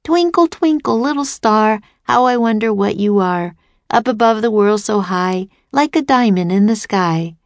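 sing-song recitation, starting high on the first word; recited nursery rhyme. A narrator's reading. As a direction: recite it like a child's poem for the samurai. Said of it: "then recited".

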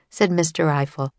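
usual speaking voice

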